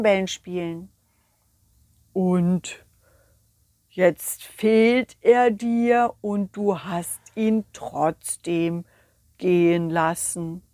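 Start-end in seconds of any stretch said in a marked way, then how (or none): 2.1-10.6 very slow, drawn-out sloth. Acted. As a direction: stretch every word like a sleepy sloth. (langsame Faultierstimme)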